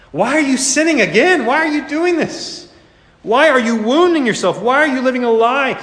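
high, urgent, anguished questions; impassioned pleading. Acted. Jesus looking at sinners living a lie, impassioned pleading. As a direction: plead with them, voice climbing high.

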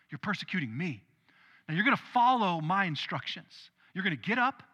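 ordinary talk

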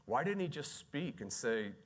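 ordinary delivery

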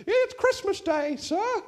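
put-on high, slow English street boy; English boy's accent. Little boy answers Scrooge in a high English accent. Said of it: "the little boy in his English accent".

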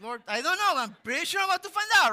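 loud, fast, wry; mock-nervous; sheepish reply. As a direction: brace for the lecture. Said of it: "I'm like".

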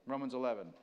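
ordinary talk

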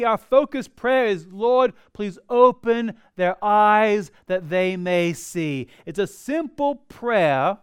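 fervent pulpit delivery; preaching. Acted. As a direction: preach it out to the congregation. Preaches with force.

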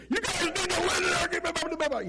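high, strained sideline yell; yelling coach. Retold or imitated. Out-of-shape coach yelling at his players on the field. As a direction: bark it like an out-of-shape coach from the bench. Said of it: "an out-of-shape coach who's yelling at the players".

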